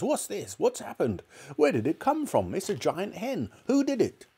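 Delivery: high, tumbling excited father; excited questions. Acted. Mister Cranky demanding answers about the hen, high and frantic. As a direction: fire off the questions in a frenzy.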